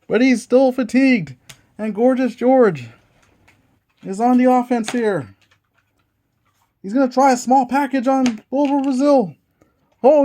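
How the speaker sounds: lively ringside play-by-play; wrestling commentator. Acted. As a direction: call the match like a TV announcer. Play-by-play of dice wrestling match.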